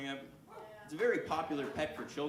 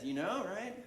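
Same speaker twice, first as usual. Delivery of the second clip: ordinary talk, nothing marked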